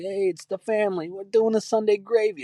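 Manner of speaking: high-pitched Italian family-man shtick; mock Italian-American guy. Italian guy showing off family dinner, high mock voice. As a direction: ham up an Italian guy online. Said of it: "they do the whole Italian thing".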